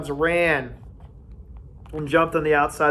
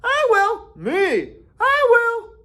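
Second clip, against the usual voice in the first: very high, loud eager cries; eager boys; 5 dB louder, much slower than usual. A narrator's reading. Boys eagerly volunteering for Jack's tribe, high and loud. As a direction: shout to be picked first.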